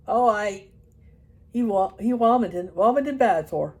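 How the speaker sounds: put-on loud Irish old man; Irish accent. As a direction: answer cheerfully like a deaf Irishman. Mulligan answers.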